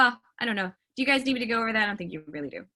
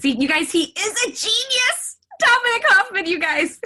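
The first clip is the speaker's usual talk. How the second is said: loud, high-pitched exclamation of delight; excited praise; 10 semitones higher, 7 dB louder than usual. Real, not acted. Celebrates helper fixing screen problem.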